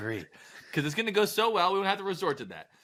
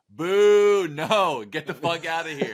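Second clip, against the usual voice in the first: loud higher booing crowd, mock heckle; jeering shout; 3 semitones higher, 8 dB louder, slower than usual. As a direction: shout him off the stage. Parliament jeering Napoleon.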